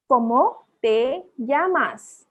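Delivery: very slow "¿Cómo te llamas?", broken word by word; teacher's slow word-by-word model; slow pronunciation demo. Shown as a demonstration. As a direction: model "¿Cómo te llamas?" for beginners, each vowel drawn out. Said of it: "vowels को कैसे pronounce करते हैं".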